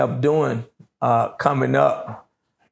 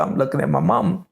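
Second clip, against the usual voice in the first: ordinary talk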